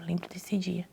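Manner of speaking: whispered memory. Real, not acted. Remembering the day quietly.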